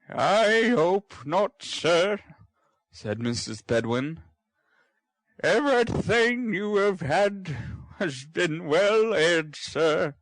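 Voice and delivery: a slightly offended servant; prim housekeeper. Acted. Housekeeper reassures her master, prim and slow.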